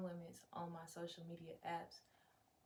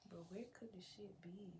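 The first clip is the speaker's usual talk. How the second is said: ordinary talk, nothing marked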